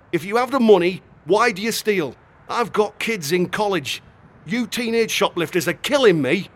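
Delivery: a fed-up owner scolding; angry shopkeeper. A narrator's reading. Shopkeeper scolding the teenage boy, exasperated.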